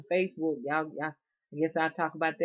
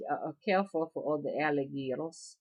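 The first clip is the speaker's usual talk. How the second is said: ordinary talk, nothing marked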